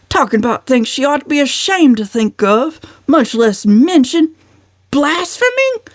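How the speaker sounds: high, indignant uncle's rant. A narrator's reading. Uncle Benjamin ranting about Valancy, indignant. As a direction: rail against her shamelessness. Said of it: "snorted Uncle Benjamin".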